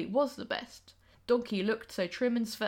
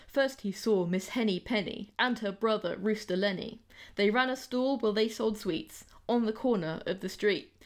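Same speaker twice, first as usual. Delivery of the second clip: usual speaking voice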